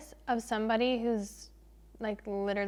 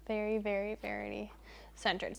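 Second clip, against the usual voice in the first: quiet repeat; 7 dB quieter than usual. Shown as a demonstration. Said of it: "repeats the joke quietly".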